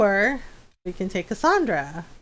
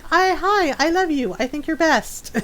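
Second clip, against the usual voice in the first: high, eager dog greeting; happy dogs; 7 semitones higher than usual. Retold or imitated. Dogs greeting returning owner, adoring and happy. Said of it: "always so happy to see you".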